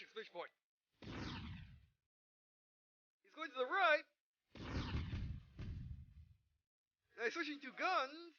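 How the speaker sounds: hammed-up anime pilot, punchy and loud in the fights; dramatic hero narration. Gamer voicing his pilot through a close mech duel, hammed-up. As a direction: play the frantic mech pilot mid-duel.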